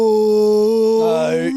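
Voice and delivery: usual speaking voice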